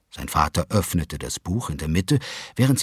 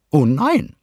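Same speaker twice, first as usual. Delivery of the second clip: much higher, sugary wife; Mrs Willoughby's voice; 8 semitones higher, 6 dB louder than usual. A narrator's reading. Wife answers husband, high and sugary. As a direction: coo it sweetly. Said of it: "antwortete Misses Willoughby".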